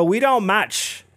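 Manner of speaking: lightly voiced rapper saying "we don't match." dismissively; mild mock dismissal. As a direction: brush him off with slow "we don't match.". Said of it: "And [name] goes".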